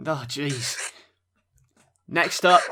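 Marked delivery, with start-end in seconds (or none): 2.1-2.6 announcer call. Acted. Hosts announcing next match.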